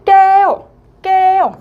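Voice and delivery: 깨요 with 우 punched high and loud; stress on 우. Shown as a demonstration. Teacher shows where the stress falls, high and loud. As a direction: lean hard into the 우 of 깨요. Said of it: "우 부분에 좀 더 강세를 주어서".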